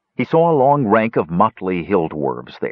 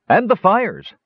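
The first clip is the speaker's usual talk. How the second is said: higher, eager soldier reporting; 13 semitones higher than usual. A narrator's reading. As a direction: voice a worried soldier.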